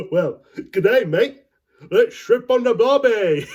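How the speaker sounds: put-on loud, high, goofy Australian accent; comic Australian impression, building to a shout; mock Australian accent. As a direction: holler it in a hammed-up Aussie accent. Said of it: "That's a lovely accent you got there".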